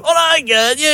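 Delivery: high, lightly imitated team whoop; mock boisterous call; rowdy cheer. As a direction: burst in like a triumphant rugby lad. Rugby team bursts in cheering.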